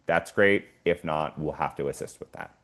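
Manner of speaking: normal talking voice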